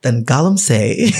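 slow radio-announcer delivery, playfully put on; playful radio voice, about to laugh. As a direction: stretch it out like a radio host. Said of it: "Do you like the, the radio voice?".

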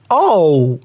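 usual speaking voice

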